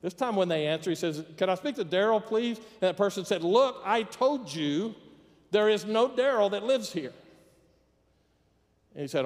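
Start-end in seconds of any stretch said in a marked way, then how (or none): 1.4-2.6 higher-pitched, innocent phone request; lightly acted courteous caller; polite caller. 3.4-7.1 higher, louder, word-by-word insistence; acted annoyance, slow and emphatic; exasperated. Annoyed person answers repeat call.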